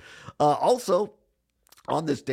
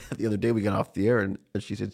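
ordinary talk

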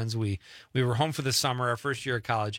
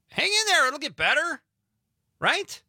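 much higher and louder, chirpy; high cheery encouragement; 14 semitones higher, 4 dB louder than usual. Acted. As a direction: cheer him on brightly.

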